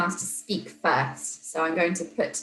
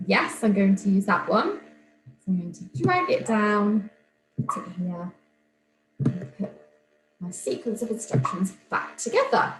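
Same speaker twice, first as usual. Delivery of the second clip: usual speaking voice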